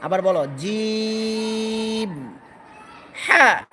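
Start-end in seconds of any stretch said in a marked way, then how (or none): 0.6-2.3 slow chanted letter name জিম; long drawn-out jim. Shown as a demonstration. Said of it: "শক্ত করো". 3.3-3.6 হ্যাঁ pushed out loud and firm from the throat; louder clipped হ্যাঁ, falling; forceful throat letter. Shown as a demonstration. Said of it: "শক্ত করে বলতে হবে".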